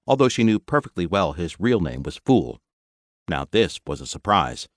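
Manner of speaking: usual speaking voice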